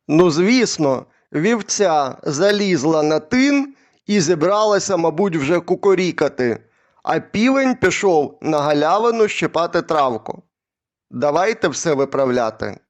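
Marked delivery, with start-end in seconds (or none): none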